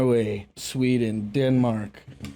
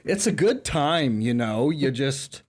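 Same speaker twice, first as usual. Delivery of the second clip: put-on Southern accent. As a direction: stretch the vowels like a languid Southerner. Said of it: "I gotta stop talking like that".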